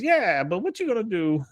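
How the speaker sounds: high-pitched mock retort; retold character reply. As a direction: toss it back in a high voice. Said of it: "He goes".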